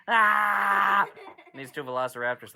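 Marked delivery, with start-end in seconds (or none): 0.1-1.1 battle cry. Acted.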